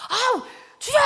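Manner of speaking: high, loud cry of anguish; wailing cry. Acted. Hezekiah weeping before God, anguished wail. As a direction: wail out as if bursting into tears. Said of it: "그러면서 그냥 막 울어요".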